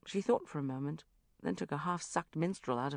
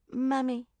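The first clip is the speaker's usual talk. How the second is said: put-on high, louder one-word child answer; disloyal child; 9 semitones higher, 5 dB louder than usual. A narrator's reading. Child naming her mother as older, disloyal. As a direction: answer promptly as a little girl. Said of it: "said Constance disloyally".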